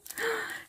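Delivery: sharp breathy gasp of delight; whispered gasp. Real, not acted. Seeing how many gems she got, breathy delighted gasp. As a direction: gasp at the sight.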